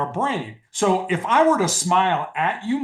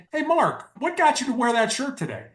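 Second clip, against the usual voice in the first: friendly and much higher, gently curious; softer question; 9 semitones higher than usual. Shown as a demonstration. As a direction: ask it with a smile in your voice. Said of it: "a little bit softer of a question".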